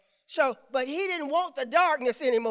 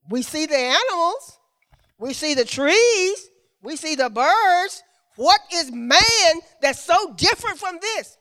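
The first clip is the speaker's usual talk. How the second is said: raised, emphatic preacher's questioning; preaching; 6 semitones higher, 9 dB louder than usual. Acted. Beings question God about man, voiced in emphatic preaching. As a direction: build each phrase up to the question.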